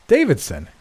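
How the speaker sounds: sharp high call of a name; crying out. A narrator's reading. Narrator's friend calls to Davidson, crying out. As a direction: call out to get his attention. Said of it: "cried I".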